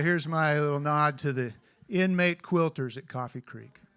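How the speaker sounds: usual speaking voice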